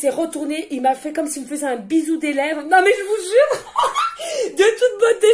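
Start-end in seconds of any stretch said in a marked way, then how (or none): 2.6-5.3 éclat de rire aigu, lent et fort; rire ravi. Real, not acted. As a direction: rire d'émerveillement sincère. Rire d'émerveillement en revoyant le geste. (rire ravi et aigu)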